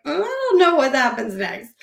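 hammed-up dismay at an unknown future; retold panicked wail. Acting out her panicked phone call.

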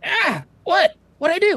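very high, startled otter; squeaky otter. Acted. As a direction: yelp in surprise. Otter protests being grabbed.

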